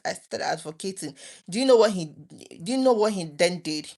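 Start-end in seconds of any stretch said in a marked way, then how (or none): none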